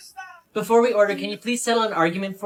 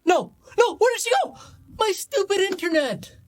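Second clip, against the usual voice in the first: much higher, anxious voice; panicked frustration; 11 semitones higher than usual. Acted. Fan losing video call, high panicked voice.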